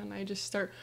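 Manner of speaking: ordinary delivery, nothing marked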